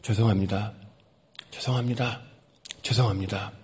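low, soft retold apologies; meek apology. Narrator answers the angry man's scolding, meek low apology. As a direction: apologise meekly, pausing between each one. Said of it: "죄송하다. 그랬어요".

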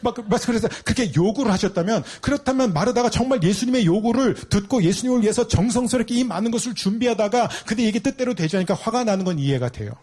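normal talking voice

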